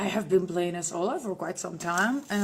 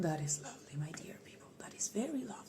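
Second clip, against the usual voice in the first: tender whisper; 2 semitones lower, 10 dB quieter, faster than usual. Acted. Whispering to fans.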